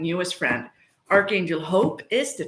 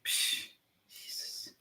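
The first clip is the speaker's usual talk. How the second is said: breathy under-the-breath Jesus; whispered aside; 15 dB quieter, slower than usual. Real, not acted. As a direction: drop to a whisper in disbelief.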